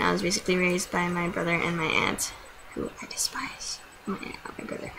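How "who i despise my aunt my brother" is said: real whispered bitter aside. Real, not acted. Telling the camera about the aunt and brother she despises, in a whisper. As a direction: drop to a hushed, unvoiced whisper.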